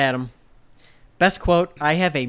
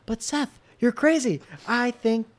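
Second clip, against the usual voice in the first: higher sung Evan voice; 8 semitones higher, slower than usual. Pretend. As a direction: put on a distinct Evan voice. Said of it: "you changed your voice for Evan".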